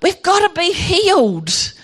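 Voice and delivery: high-pitched cry to God; retold urgent plea. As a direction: plead upward for healing. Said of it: "And I'm going".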